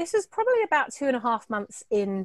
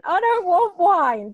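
high, loud, slow teary whine; mock a tearful, stubborn grown child; imitating crying self; 9 semitones higher, 9 dB louder, slower than usual. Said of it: "I'm just crying".

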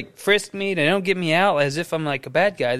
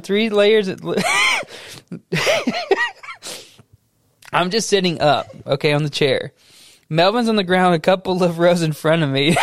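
usual speaking voice

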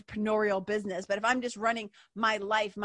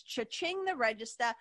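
usual speaking voice

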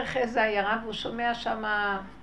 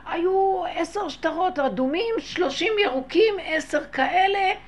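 high, drawn-out recital of details; acted eager listing; 7 semitones higher, 4 dB louder than usual. Widow giving the finder signs of her money, high insistent listing. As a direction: reel off each item slowly in a raised voice.

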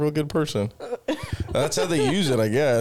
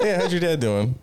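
laughing; 6 semitones higher than usual. Real, not acted. Joking with laugh.

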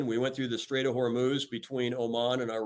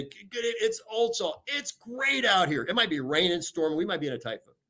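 upbeat announcement; 6 semitones higher than usual. Retold or imitated. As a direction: greet the whole crew like it's the best day ever. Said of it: "And I'd say".

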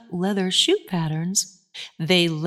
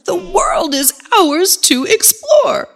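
joyful; 7 semitones higher, 3 dB louder than usual. Acted. Elves cheer in new clothes.

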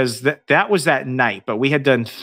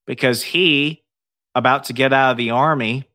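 ordinary talk, nothing marked

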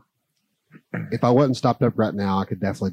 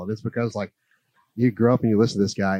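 ordinary talk, nothing marked

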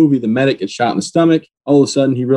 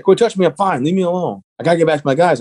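higher-pitched retold protest; insistent protest; 6 semitones higher than usual. Wounded sergeant resisting the nurse's hands, insistent protest. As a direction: push back insistently, pitch raised.

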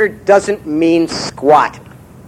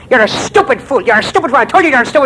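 haranguing leprechaun; 6 semitones higher than usual. Acted. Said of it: "haranguing the other one".

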